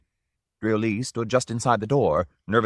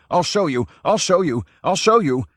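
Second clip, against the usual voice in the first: loud, higher repeated shout; 8 semitones higher, 5 dB louder than usual. A narrator's reading. Drioli running down the gallery, shouting. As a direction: build it each time. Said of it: "running down the gallery shouting".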